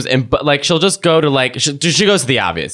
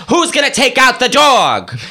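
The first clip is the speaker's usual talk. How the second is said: high, slow nagging call; higher hammed-up nag; mock nagging; 10 semitones higher, slower than usual. Medium at home mimicked.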